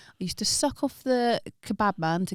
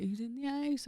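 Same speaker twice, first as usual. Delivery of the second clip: dad; 8 semitones higher, 7 dB quieter than usual. Retold or imitated. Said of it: "My dad would come down".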